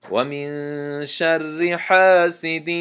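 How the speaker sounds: slow melodic tajweed chant, drawn out; Quran recitation. Shown as a demonstration. Teacher recites al-Falaq to students in slow tajweed chant. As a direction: recite each verse slowly, measured and melodic.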